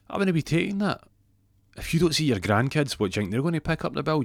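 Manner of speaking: high-pitched, pushy challenge; acted protest. Jackie challenges stressed Ron about his grandkids, acted protest.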